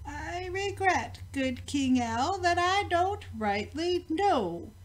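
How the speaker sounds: slow answer. A narrator's reading. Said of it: "he answered quite slow".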